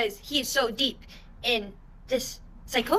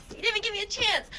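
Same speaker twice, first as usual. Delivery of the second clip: higher, faster mock whine; 8 semitones higher, faster than usual. Neighbor complaining from across the street, mock whine. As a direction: whine it like a sulking kid. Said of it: "he's like".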